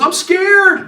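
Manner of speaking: high, loud mock-scared wail; mock yelling. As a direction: imitate a frightened suspect crying out. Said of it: "he's yelling".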